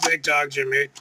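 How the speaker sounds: ordinary delivery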